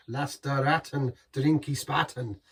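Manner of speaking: slow, hammed-up bad German accent; mock German accent. Vlogger repeats the beer slogan in a hammed-up German accent. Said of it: "Terrible German accent there".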